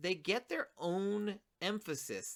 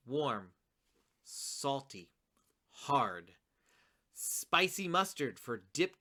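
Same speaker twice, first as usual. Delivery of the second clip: "Large", "brown", "warm", "salty", "hard" as five separate falling beats; every word its own line; 3 semitones lower than usual. Shown as a demonstration. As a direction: pause after each describing word, then flow into "Spicy mustard for dip". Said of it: "They all are so important that they get their own line".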